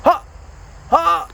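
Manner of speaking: high loud whooping call; bigfoot call. Pretend. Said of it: "my Bigfoot call".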